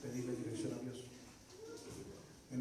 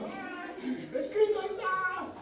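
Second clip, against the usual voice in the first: high, slow, drawn-out cry; shouting; 5 dB louder, much slower than usual. Retold or imitated. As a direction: proclaim it at full force. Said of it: "y yo gritando". (grito proclamando, agudo y lento)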